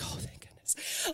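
whispered relief under the breath; relieved whisper. Retold or imitated. Tired mother handing off the baby, whispering relief. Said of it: "I'm like".

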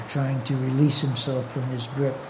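plain reading voice

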